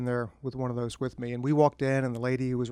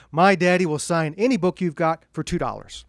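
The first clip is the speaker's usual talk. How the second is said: mock yell, louder; retold kid's scream; 4 semitones higher, 7 dB louder than usual. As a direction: shout it like a little boy across the store. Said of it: "screaming at the top of his lungs".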